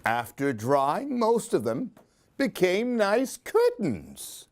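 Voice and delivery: put-on lively read-aloud for a child; expressive children's story reading; storybook narration. A narrator's reading. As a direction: tell it like a bedtime picture book. Reads picture book aloud.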